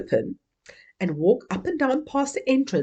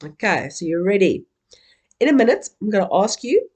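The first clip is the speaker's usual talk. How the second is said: ordinary talk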